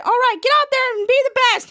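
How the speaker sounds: loud, high rallying call; coach pep shout. Retold or imitated. Coach sending his players onto the court with a shout. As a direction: fire the team up as you send them out. Coach Henry sends team out.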